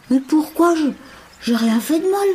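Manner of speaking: put-on high, pleading child voice; a child protesting to his mentor; young boy Mowgli.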